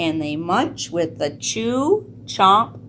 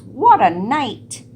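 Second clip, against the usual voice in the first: mole muttering; 5 semitones higher, 7 dB louder than usual. A narrator's reading. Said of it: "Mole mutters".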